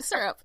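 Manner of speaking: "syrup" collapsed into "surp"; one-syllable syrup. Shown as a demonstration. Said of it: "That's how I say it".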